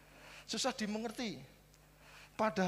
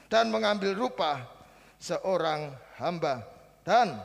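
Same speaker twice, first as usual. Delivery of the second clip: scripture reading; 5 semitones higher, 11 dB louder than usual. Acted. Preacher reads Philippians to the congregation, loud and solemn. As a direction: proclaim the verse to the church.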